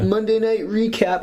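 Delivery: hammed-up show title; mock segment announcer. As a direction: announce it like a cheesy jingle.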